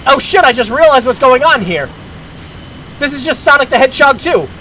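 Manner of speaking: excited realization. Real, not acted. Sudden realization while playing.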